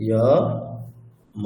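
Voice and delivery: each syllable alone, long pause between; deliberate, isolated syllables. Shown as a demonstration. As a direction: sound out syllables one at a time. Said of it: "প্রত্যেকটা অক্ষর just উচ্চারণ করে করে".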